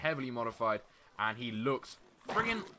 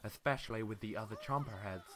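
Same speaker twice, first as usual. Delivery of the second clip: a hint of a fashion designer; lightly put-on fabulous designer; clothes designer voice; 4 semitones lower, 4 dB quieter than usual. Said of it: "that's my voice of a, a, um, clothes designer".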